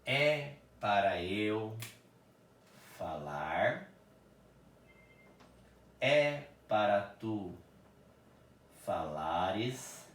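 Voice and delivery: each pronoun and verb form set apart slowly; slow teacher dictation. Shown as a demonstration. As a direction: lay out the conjugation slowly, word by word. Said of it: "vou usar mais uma frase assim".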